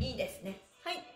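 ordinary talk

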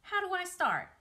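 high, loud eager question; an excited pig itching to begin. Acted. Wilbur asks Charlotte how to start, eagerly.

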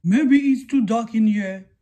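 loud friendly dad; lightly voiced daddy shark. Dad shark speaking to his son, a hint of a loud papa voice.

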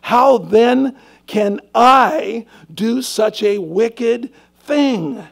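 loud, slow, stretched-out cry of the question; slow, loud pulpit emphasis on wicked thing; emphatic preaching. Shown as a demonstration. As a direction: drive the question home to the room. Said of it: "He said".

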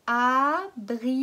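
abricot drawn out slowly, syllable by syllable. Shown as a demonstration. Teacher slowly models Abricot for pupils. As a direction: spell out Abricot slowly for children. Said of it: "redites-le".

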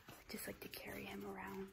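soft unvoiced whisper. Real, not acted. Owner whispering while carrying her puppy, hushed. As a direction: breathe it so the pup stays calm.

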